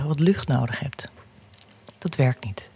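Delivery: soft whisper. Acted. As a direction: drop to a hushed whisper. Whispered within meditation.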